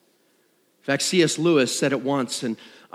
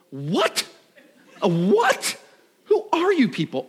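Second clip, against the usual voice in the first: rising mock disbelief, top one much higher; mock indignation; 8 semitones higher, slower than usual. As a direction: act out shocked disbelief at a post. Mock shock at Facebook post.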